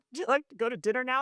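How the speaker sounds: bright, quick casual invite; lightly voiced cheerful dad. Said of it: "he's like".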